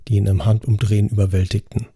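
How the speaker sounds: plain reading voice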